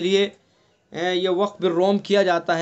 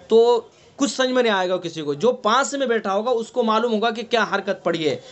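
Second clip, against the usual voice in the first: usual speaking voice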